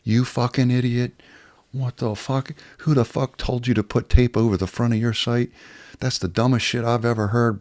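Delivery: army instructor berates the student, mock furious; retold angry tirade. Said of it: "he fucking laid into my ass".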